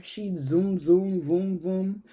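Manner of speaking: playful engine noise, zoom voom; car zoom noises. Retold or imitated. Tricycle racing like a car.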